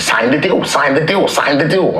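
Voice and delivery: high-pitched, repetitive gremlin imitation. Retelling manager's changed voice on the phone, as a high gremlin. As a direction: chant it like a pestering gremlin. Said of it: "like, a gremlin".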